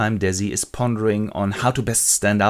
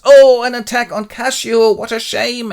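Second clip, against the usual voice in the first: high, hammed-up cry of alarm; shouts; 12 semitones higher, 9 dB louder than usual. Iago feigns alarm at attack on Cassio, mock shout. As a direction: shout in fake dismay. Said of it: "Iago comes and shouts".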